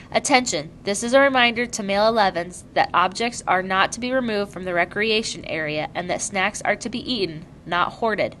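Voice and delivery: usual speaking voice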